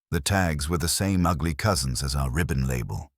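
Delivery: ordinary delivery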